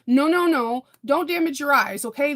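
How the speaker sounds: urgent firm warning; insistent no. Real, not acted. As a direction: stop them firmly, no messing. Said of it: "insistent warning".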